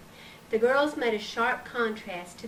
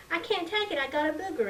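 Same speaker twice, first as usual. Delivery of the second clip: put-on little girl voice; 7 semitones higher, faster than usual. Little girl excuses herself.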